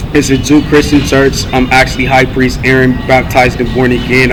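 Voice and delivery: ordinary delivery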